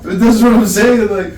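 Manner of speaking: high, loud burst of laughter spilling into words; real laughter while talking to a friend; laughing. Real, not acted. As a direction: laugh through the line, cracking up. Laughing at the hate crime joke.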